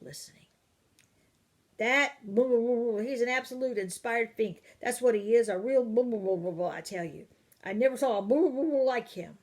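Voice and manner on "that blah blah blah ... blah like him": loud, high blustering tirade; father raging about an inspired fink; furious ranting. Acted. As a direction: pour out the complaint in a torrent. Father ranting to his wife.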